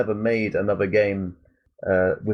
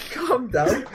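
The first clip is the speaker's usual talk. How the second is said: much higher, laughing put-on voice; laughing mock scolding; 8 semitones higher than usual. Arguing with Axel in the game, high and laughing. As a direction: scold the character while cracking up.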